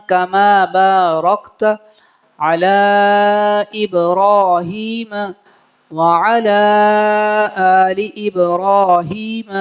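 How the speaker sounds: slow, careful Quranic-style chant; slow recitation. Acted. Teacher reciting the prayer slowly for students. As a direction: recite each word slowly, measured.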